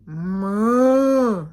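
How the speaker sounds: loud lowing moo; cow moo. A narrator's reading. Cow answering Duck, loud moo.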